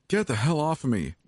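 high, defiant outburst; spat. A narrator's reading. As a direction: spit it out at an attacker. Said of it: "I spat at him".

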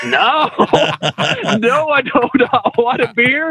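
high, stretched-out "no", half laughing; high-pitched comic protest; incredulous refusal. Acted. Acted refusal of offered beer.

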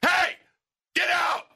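high, loud intimidating shout; big voice. Retold or imitated. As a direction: bellow to scare an intruder off. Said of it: "I used my big voice".

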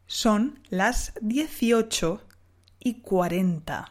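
slow, deliberate dictation; slow and careful. Shown as a demonstration. Teacher reading out the exercise time, slow. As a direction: say each word slowly.

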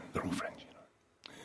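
unvoiced conspiratorial whisper; whispered aside. Host repeating his claim as a sly whispered aside. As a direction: whisper it behind your hand.

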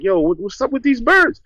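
high, startled retold question. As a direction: imitate his own spooked surprise at the birds. Retelling alarm at crows.

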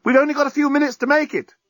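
high, urgent call; journalist's voice. Acted. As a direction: hurry them on. Journalist urging.

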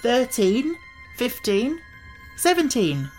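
high, measured count with pauses; counting aloud. A narrator's reading. Tip counts up to seventeen by twos, counting aloud. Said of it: "counted Tip".

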